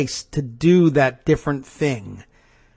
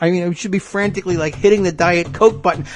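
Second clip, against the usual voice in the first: ordinary talk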